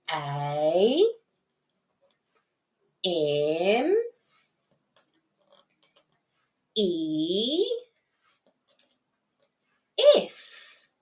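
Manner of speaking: A, M, E, S named one by one, far apart; A, M, E, S as drawn-out letter names. Shown as a demonstration. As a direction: spell out A, M, E, S with long pauses. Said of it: "tell them the letter names".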